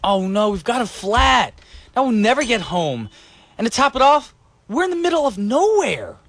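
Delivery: hammed-up roadside despair; acted stranded dismay. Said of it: "Brian and Punita just having a conversation".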